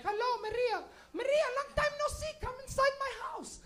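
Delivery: put-on high, friendly accented welcome; sweet immigrant lady. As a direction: greet an old friend sweetly. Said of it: "every immigrant lady you know will".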